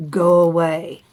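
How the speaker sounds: loud, stern grumpy bear; grumpy bear telling goslings off, loud and stern. Acted. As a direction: shoo them off sternly. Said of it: "Bruce was very stern".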